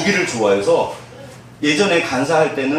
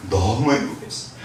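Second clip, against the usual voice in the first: usual speaking voice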